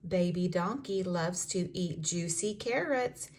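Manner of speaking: plain reading voice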